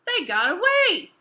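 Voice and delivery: high loud alarmed shout. Acted. Bert telling Ethel the pigs escaped, shouting. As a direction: bellow the bad news. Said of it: "shouted Bert".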